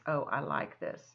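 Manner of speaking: ordinary delivery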